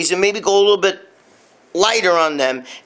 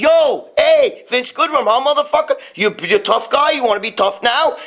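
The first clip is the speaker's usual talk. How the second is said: confrontational yell; 7 semitones higher than usual. Retold or imitated. A friend retells a tough guy confronting Vince, taunting yell. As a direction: shout it in his face like a New York tough. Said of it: "He said".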